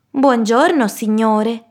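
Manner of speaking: high, singsong piglet greeting; high-pitched young pig voice; polite middle pig. Acted.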